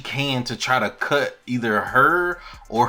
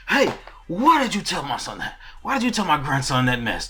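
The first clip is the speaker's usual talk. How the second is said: mock indignant demand, higher on the first word; huff and puff; 4 semitones higher than usual. Viserys confronting him over the rumor, in a huff. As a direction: retell it as a huffy confrontation. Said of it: "in his big huff and puff".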